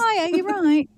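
lifted, jokey English voice; host teasingly imitating the guest's English phrasing; mock English greeting. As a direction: toss off a cheery Brit hello. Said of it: "And just say".

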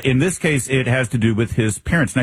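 ordinary delivery

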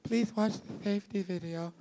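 soft announcer voice for the safety video; cabin announcement. Retold or imitated. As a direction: read it like a mellow onboard recording. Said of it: "sometimes you gotta watch".

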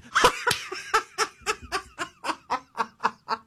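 high, breathless run of laughter; real laughing fit. Real, not acted. Host cracking up at his assistant's joke, real laughing fit.

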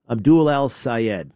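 slow, each syllable of "Abdul Al Sayed" spelled out carefully; slow careful name. Shown as a demonstration. Host showing he can say the name, slow and careful. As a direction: sound out "Abdul Al Sayed" slowly and deliberately. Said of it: "I can say that".

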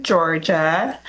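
ordinary delivery

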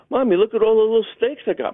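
put-on high, excited child showing his mother; a boy proud of his snakes; little kid voice. Boy [name] to his mommy, childlike high voice. Child self talking to mom.

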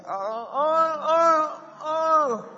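high, drawn-out "ah" cries; ecstatic cries imitated. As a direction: re-enact loud high cries of spiritual rapture. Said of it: "yo allá en la cuarta dije". (gritos agudos de éxtasis imitados)

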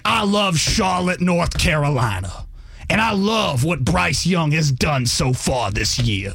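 slow, drawn-out sportscaster impression; Stephen A Smith. Radio guest imitating an on-air pundit for co-hosts, slow drawn-out impression. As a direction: stretch the words out like a grandstanding TV pundit. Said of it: "Stephen A's up there like".

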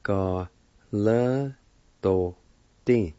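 each syllable said separately, with a pause after each; syllables apart. Shown as a demonstration. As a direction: break it into single syllables. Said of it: "Sing apart".

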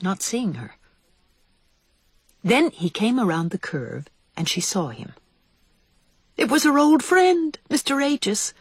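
plain reading voice

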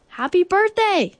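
high, projected bear voice; bear's birthday wish. A narrator's reading. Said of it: "said Bear".

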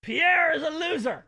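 loud, high jeering shout; mocking shout. Real, not acted. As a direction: taunt the loser. Host mocking losing contestant.

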